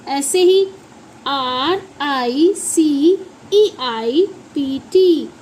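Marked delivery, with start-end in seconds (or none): 1.3-5.3 slow letter-by-letter spelling; measured classroom spelling. Shown as a demonstration. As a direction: spell it out one drawn letter at a time. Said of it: "इसकी pronunciation होती है".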